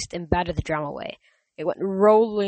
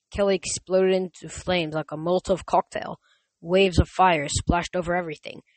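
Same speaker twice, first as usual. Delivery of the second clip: ordinary delivery, nothing marked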